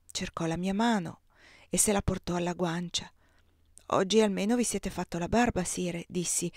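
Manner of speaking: ordinary delivery, nothing marked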